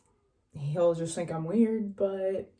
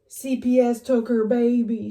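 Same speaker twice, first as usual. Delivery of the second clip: louder mock quote; hammed-up hillbilly quote; quoting the show; 4 semitones higher, 6 dB louder than usual. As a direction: blurt it like a reality-show rant. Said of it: "then we're like".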